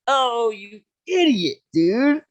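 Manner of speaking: high, slow, drawn-out scolding of a fool; exasperated. Retold or imitated. Scolding the buddy who confessed to the cop, high and drawn-out. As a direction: stretch out idiot like you can't believe it.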